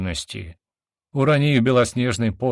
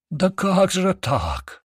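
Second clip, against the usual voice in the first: high, drawn-out wail of grief; grief-stricken cry; 11 semitones higher, much slower than usual. A narrator's reading. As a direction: cry out in anguish, stretching the words. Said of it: "с горем вскрикнул огромный ящер". (горестный, протяжный вскрик)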